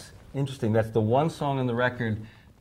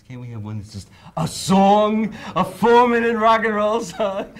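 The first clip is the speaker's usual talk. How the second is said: pleading; 11 semitones higher, 10 dB louder, slower than usual. Retold or imitated. Todd pleading for shorter song.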